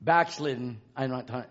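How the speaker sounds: ordinary talk, nothing marked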